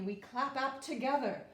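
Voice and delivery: ordinary delivery, nothing marked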